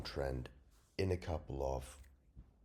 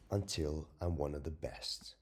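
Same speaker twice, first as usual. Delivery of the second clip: ordinary delivery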